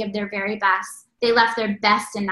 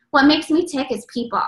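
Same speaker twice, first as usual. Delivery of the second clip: normal talking voice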